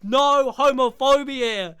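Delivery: loud, high-pitched hammed-up telling-off; mock angry confrontation. Acts out Joel confronting a homophobe.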